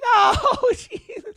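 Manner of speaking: a high, bashful laugh; flattered giggle. Retold or imitated. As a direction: squeal and giggle at the compliment.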